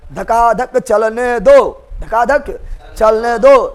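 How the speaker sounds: very slow, stretched "धकाधक चलने दो"; drawn-out emphasis. Real, not acted. Same phrase repeated for emphasis. ("धकाधक चलने दो" खींच-खींचकर, बहुत धीरे)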